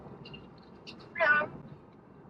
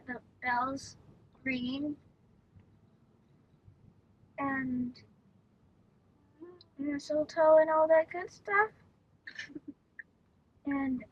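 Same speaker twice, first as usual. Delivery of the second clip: hushed lower story narration; soft storytelling; 6 semitones lower, 8 dB quieter than usual. Acted. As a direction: tell it softly, like a bedtime story.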